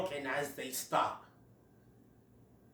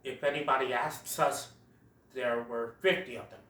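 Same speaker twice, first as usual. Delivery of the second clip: lower, softer gruff aside; Diego voice; 6 semitones lower, 6 dB quieter than usual. Retold or imitated. Diego muttering to Sid after falling, lower voice. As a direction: grumble it to save face. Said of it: "Diego says".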